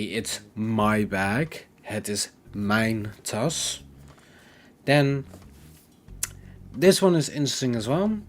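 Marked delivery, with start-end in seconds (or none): 0.0-3.8 slow teacher demo of "Het is mijn tas"; Dutch example. Shown as a demonstration. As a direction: spell it out slowly for learners. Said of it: "you would say".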